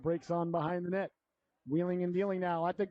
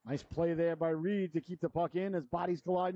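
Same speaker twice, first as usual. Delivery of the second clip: usual speaking voice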